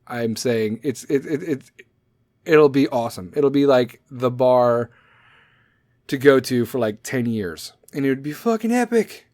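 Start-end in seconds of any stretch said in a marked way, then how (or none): none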